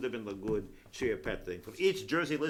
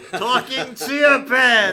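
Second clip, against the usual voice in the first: excited; 10 semitones higher, 12 dB louder, much slower than usual. Real, not acted. Excited about commercial idea.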